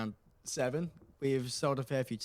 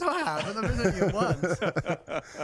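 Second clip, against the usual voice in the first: laughing protest; 6 semitones higher, 7 dB louder, slower than usual. Real, not acted. Protesting host's teasing claim.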